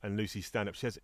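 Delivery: usual speaking voice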